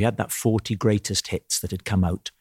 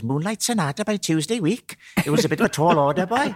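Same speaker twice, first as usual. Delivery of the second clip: much higher incredulous Welsh lilt; Welsh piano teacher; 10 semitones higher than usual. Retold or imitated. Welsh teacher scoffing at pupil's request, high Welsh lilt. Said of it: "And he goes".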